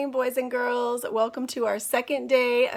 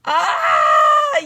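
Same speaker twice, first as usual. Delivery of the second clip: hammed-up kid's shriek, much higher and louder; a big dramatic shriek; yell; 9 semitones higher, 9 dB louder than usual. Andrew's hair gets spit on, loud mock shriek. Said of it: "yelled Andrew".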